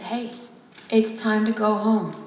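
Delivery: a hint of a firm policeman calling. A narrator's reading. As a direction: hail her firmly across the lawn. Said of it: "A man's voice said".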